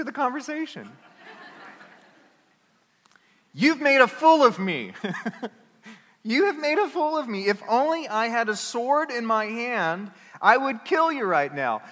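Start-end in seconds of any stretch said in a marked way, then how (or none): 3.5-4.9 high, loud, indignant cry; hammed-up fury at the donkey; a man yelling at his donkey; mock angry outburst. 6.2-11.8 high, loud mock fury; preacher voicing a furious Balaam; hammed-up rage. Balaam threatens donkey.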